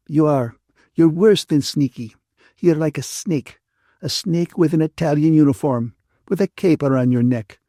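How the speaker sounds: cross Ferguson, scolding. A narrator's reading. Ferguson rebukes the man who got Catherine into a mess, scolding. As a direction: scold them as the disapproving friend.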